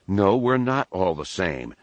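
ordinary delivery, nothing marked

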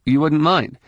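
ordinary delivery

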